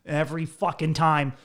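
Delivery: ordinary talk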